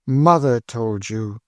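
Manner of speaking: slow, bitter weight on Mother; bitter stress on mother. Acted. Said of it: "bitter emphasis on the word mother".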